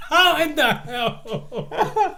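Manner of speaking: slow high laughing. Real, not acted. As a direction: laugh it out slowly. Laughing at the idea.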